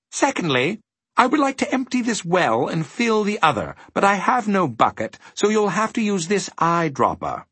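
high, courteous gentleman voice; agreeable faceless gentleman. A narrator's reading. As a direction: hand out a chore pleasantly, pitched high.

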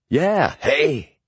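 high, bright friendly hello; genial greeting. A narrator's reading. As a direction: greet Boris like an old pal. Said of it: "said Martin genially".

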